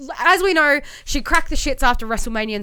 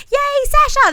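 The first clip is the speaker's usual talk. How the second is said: much higher, squealed fake cheer; mock crowd cheer; 5 dB louder, slower than usual. Mocking fans who cheer Sasha's return, high squealed fake cheer. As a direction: squeal it like a gushing fan.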